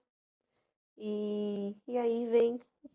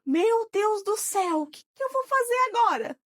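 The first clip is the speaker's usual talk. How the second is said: retold panic; 9 semitones higher, 5 dB louder than usual. Discovering she is pregnant, panicked and loud. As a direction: wail it out in dismay.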